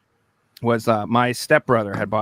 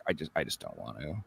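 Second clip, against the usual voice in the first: much lower, soft and reluctant; 13 semitones lower, 14 dB quieter than usual. Retold or imitated. Player afraid of the dark hallway, low soft reluctant. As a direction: mumble that you don't want to go. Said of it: "stand there and be like".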